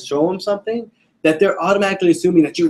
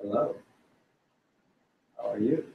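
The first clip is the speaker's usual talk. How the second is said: much softer, flat hello; flat, low energy; 5 semitones lower, 13 dB quieter than usual. Shown as a demonstration. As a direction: say it like you don't care. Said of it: "not a very good connection".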